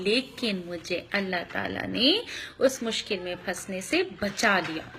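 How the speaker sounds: ordinary talk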